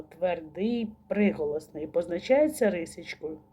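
ordinary talk, nothing marked

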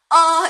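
어 blurred halfway between 으 and 어; muddy in-between 어 as a mistake demo; ambiguous middle vowel. Shown as a demonstration. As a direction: noncommittal 어, neither vowel clearly formed. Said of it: "이렇게 중간 어정쩡하게 하고 계신지".

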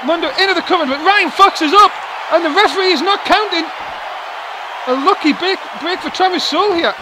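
high, loud fired-up play-by-play; wrestling commentator. Acted. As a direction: ride the drama of the near fall. Near fall, commentator excited.

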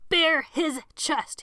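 high, loud, slow command. A narrator's reading. Sebastian commanding the goons to strip Colin. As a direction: drag out the order. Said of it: "he commanded".

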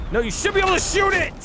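high, fast shout; exasperated yell at the game; frustrated shout. Real, not acted. Frustrated gamer yelling at game.

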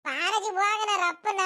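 put-on slow, whiny boy's call to grandma; hint of a nagging grandson, drawn out; impatient boy. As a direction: pester grandma like a restless kid. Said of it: "ಬಾರಜಿ ಹೋಗಣ ರಪ್ಪನ ಅಂತ ಹೇಳಿರೋದು".

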